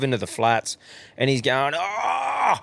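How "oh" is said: high, hammed-up yelp of pain; mock pained cry. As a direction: cry out like you just ate dirt. Mates mimic injured Dickel.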